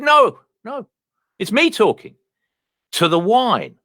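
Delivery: wife's voice. Acted. Comic retelling the wife's reply to him, in a high put-on voice.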